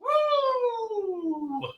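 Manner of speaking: very high wrestler's whoop; Ric Flair woo. Retold or imitated. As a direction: let out a Nature Boy woo. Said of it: "you got a Ric Flair yell there".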